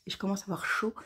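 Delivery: a quick breathy aside; whispered aside. Real, not acted. Knitter confiding to viewers that she's heating up, whispered. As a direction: whisper it as a confession. Aside about getting hot.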